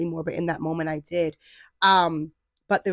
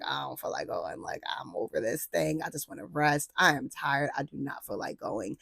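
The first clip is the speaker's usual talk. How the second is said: much softer, lower, weary; tired and reluctant; 4 semitones lower, 9 dB quieter than usual. Retold or imitated. Imitating her tired self not wanting to go to the retreat. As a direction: drag out the refusal like you're drained. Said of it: "that weekend I was like".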